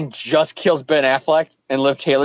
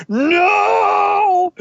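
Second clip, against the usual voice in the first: high, crying mock scream of no; mock anguished no; 13 semitones higher than usual. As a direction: wail it like a melodramatic villain. Kane's dramatic outburst in comic.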